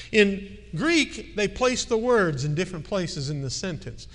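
ordinary delivery

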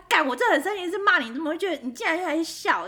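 high, loud exasperated scolding; half-joking indignant yell; mock angry. Wife retelling scolding her laughing husband, loud. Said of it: "我就觉得他".

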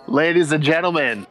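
hammed-up announcer introducing the fighters. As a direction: stretch every name like a boxing announcer. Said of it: "the announcer kind of".

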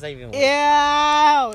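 high, loud disbelieving squeal; weirded out. Real, not acted. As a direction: shriek it in disbelief. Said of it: "that's just weird".

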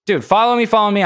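be the cat begging him to follow; cat's plea. Pretend. Voicing the cat.

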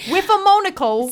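high, loud put-on British; working-class British, hammed up; British accent. Shown as a demonstration. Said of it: "my go-to in a British accent".

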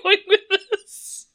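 high-pitched, slowed by laughter; laughing disbelief. Real, not acted. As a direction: ask it while cracking up. Baffled co-host cracking up at story.

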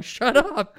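high, hissed-free squeaky mock hush; mock staffers. Host retells staffers hushing Hillary, high mock voice. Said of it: "all of her staffers are going".

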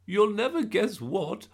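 high, loud, breathless gran; out-of-breath, high-pitched excitement; panting excitement. A narrator's reading. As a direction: burst out with big news, panting. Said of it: "she panted".

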